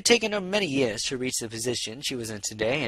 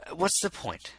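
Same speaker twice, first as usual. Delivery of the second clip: irritated and disappointed; 6 semitones higher, slower than usual. A narrator's reading. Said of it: "both irritated and disappointed".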